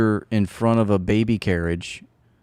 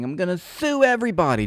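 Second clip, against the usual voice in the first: mock Dershowitz; 9 semitones higher than usual. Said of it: "Alan Dershowitz saying".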